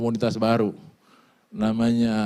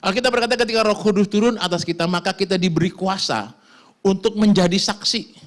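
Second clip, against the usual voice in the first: ordinary delivery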